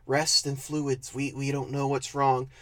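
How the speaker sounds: mock clueless doctors. Doctors shrugging at the pale sister, muttered mockery. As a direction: mumble it like a baffled doctor. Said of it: "the doctors".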